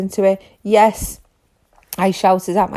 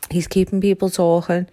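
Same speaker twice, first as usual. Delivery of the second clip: ordinary talk, nothing marked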